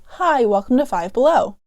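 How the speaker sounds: ordinary delivery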